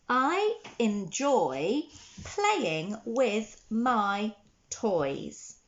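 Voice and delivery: very slow, each word drawn out for writing; slow dictation. Shown as a demonstration. Teacher dictating a sentence to children, very slowly. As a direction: dictate it slowly so they can write along. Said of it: "the sentence we're going to write today is".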